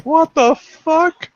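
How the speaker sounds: high, slow, drawn-out incredulity; stunned disbelief. Retold or imitated. Reacts to shocking movie scene.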